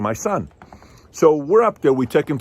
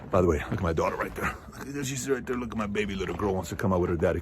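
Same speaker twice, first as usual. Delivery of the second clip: tender aside to daughter; 6 dB quieter, faster than usual. Real, not acted. Aside to his little daughter.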